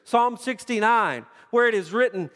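sermon climax, voice lifted high and loud; full-force preaching. Acted. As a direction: preach it out with rising fervor.

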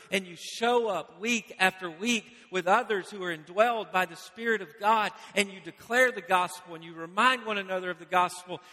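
ordinary talk

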